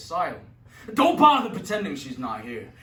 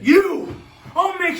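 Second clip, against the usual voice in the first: furious shout; 12 semitones higher, 10 dB louder, slower than usual. Acted. Judge spots the sailor.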